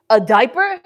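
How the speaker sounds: loud indignant rising question; outraged, can't believe it; shocked disbelief. Real, not acted. Reading an insulting comment, shocked.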